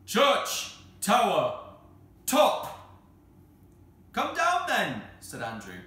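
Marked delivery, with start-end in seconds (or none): 0.0-2.8 spaced-out single-word shouts; very slow, loud. A narrator's reading. Cyril answers from the church tower, slow loud shouts. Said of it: "in his deepest voice, very slow and loud". 4.1-5.0 high, loud hail upward; shouting. Acted. Andrew orders the tower voices down, shouting.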